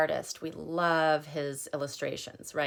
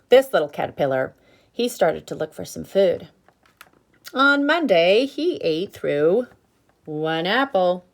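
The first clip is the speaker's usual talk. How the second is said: louder, slowed, emphatic picture-book reading with the hungry refrain swooping up; reading to children; 4 semitones higher, 7 dB louder than usual. Acted. Teacher reading aloud to children, dramatic refrain. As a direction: make every day a build to "still hungry".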